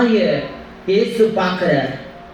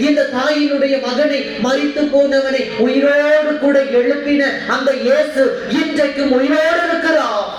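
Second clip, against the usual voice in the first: preaching proclamation; 4 semitones higher than usual. Acted.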